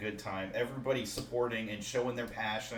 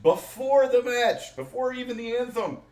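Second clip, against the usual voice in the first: much higher, louder excited shout; 13 semitones higher, 9 dB louder than usual. Real, not acted. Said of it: "repeats before the match".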